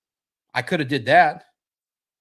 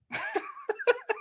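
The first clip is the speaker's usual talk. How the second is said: a sudden high squeal of laughter; 7 dB quieter than usual. Real, not acted. Laughing at himself to his viewers, a high squeal.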